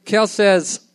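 loud, animated story reading; story narration. Acted. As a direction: project the tale with punchy energy. Reads a story aloud.

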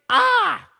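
high, loud shriek of a fleeing spirit; spirit's scream. A narrator's reading. Evil spirit screaming as Jesus drives it out of the boy. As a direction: let out a piercing demon scream. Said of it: "Then the spirit screamed".

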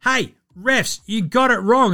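a hint of an outraged coach; mock coach protest. Coach yelling at refs.

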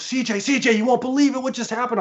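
high, excited mimicry of a friend; retold excitement. Michael rushes up to tell CJ the news, excited. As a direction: burst out with big news, pitched well up. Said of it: "And he's all excited".

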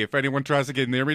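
usual speaking voice

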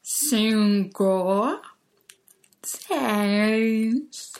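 each number chewed slowly like a cow eating grass. Shown as a demonstration. Teacher showing the class how to chew each number. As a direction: chew every word with exaggerated mouth. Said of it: "Imagínense que son una vaca comiendo pasto".

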